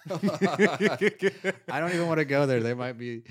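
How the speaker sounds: high, slow laughing. Real, not acted. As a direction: laugh through the refusal. Laughing refusal.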